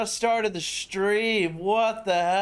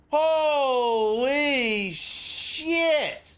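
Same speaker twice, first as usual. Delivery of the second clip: long drawn-out, higher holy shit; stunned exclamation; 7 semitones higher, much slower than usual. Real, not acted. Streamer seeing a huge donation, slow stunned disbelief. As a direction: stretch it out in disbelief.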